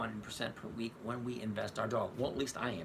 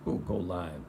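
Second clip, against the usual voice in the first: usual speaking voice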